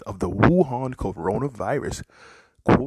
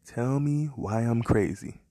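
ordinary talk, nothing marked

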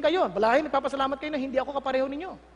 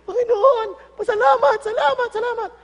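excited; 9 semitones higher, 8 dB louder than usual. Pretend. Healed man thanking the Lord.

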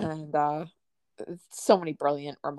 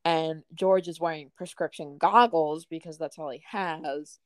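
ordinary talk

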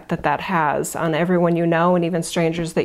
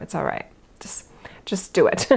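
whisper; 4 dB quieter than usual. Real, not acted. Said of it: "unvoiced whisper".

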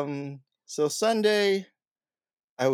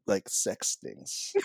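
hushed aside; 5 semitones lower, 6 dB quieter, much slower than usual. Real, not acted. Embarrassed aside about purchases.

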